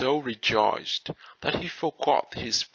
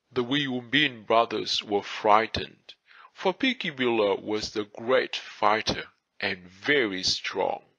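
ordinary delivery, nothing marked

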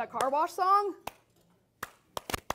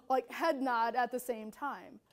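ordinary talk, nothing marked

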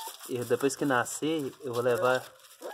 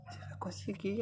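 whisper; 6 semitones higher, 7 dB quieter than usual. Real, not acted. Encouraging dog in hushed voice.

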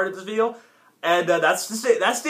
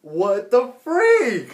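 drawn out slowly in disbelief; 3 semitones higher, much slower than usual. Real, not acted. Viewer stunned by the cartoon, slow and drawn out. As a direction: stretch each word, stunned.

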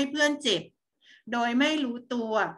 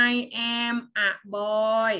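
put-on very slow, drawn out for kids to repeat; read-along model; much slower than usual. Shown as a demonstration. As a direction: model each word slowly for the class. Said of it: "อ่านตามนะคะ". (อ่านช้ามากให้เด็กอ่านตาม)

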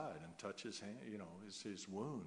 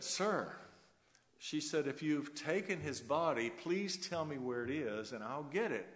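higher, louder, anxious plea of a grieving woman; pleading Mary; 6 semitones higher, 4 dB louder than usual. Retold or imitated. Mary at the empty tomb begging the gardener, higher pleading voice. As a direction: beg the gardener for the body, voice lifted.

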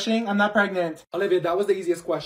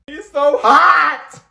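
dragged-out, louder, higher swoon; 7 semitones higher, 9 dB louder, much slower than usual. Acted. Girl gushing about her crush, swooning slowly. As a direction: gush it slowly like a lovestruck fan.

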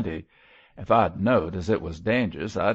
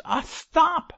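very high, loud scream to stop; anguished shriek; 5 dB louder than usual. A narrator's reading. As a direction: shriek it desperately to drown out the prayer. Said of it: "shrieked the unhappy man".

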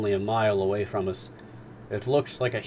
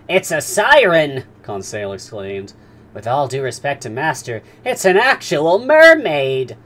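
higher and louder, thrilled discovery; excited servant; 6 semitones higher, 13 dB louder than usual. Acted. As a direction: burst out with a wonderful find. Conseil thinks it's mermaid.

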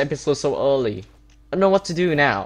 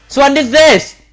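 angry accusation; 9 semitones higher, 8 dB louder, slower than usual. Real, not acted. Blames a player for griefing.

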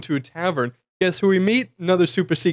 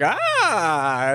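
startled ah; 3 semitones higher than usual. Retold or imitated. Field marshal caught off guard by the intruders, startled held ah. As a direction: jump as they burst in. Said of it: "he's like".

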